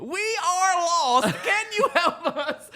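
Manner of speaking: high, slow pleading voice through laughter; lost travellers asking a local for help; mock lost traveller. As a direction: beg for help like lost tourists. Acted lost travellers scene.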